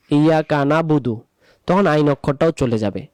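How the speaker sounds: ordinary talk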